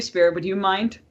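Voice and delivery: ordinary delivery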